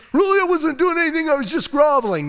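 high, loud, fast protest; a caught man blurting excuses; panicked squeaky denial; flustered Haman. Retold or imitated.

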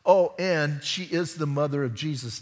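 usual speaking voice

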